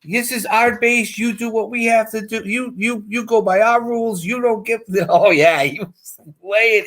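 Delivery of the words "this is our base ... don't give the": officer chewing out. Retold or imitated. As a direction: lay into him as the little officer, pitched up. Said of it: "he was out there yelling at him".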